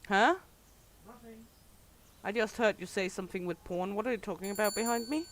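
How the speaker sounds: ordinary talk, nothing marked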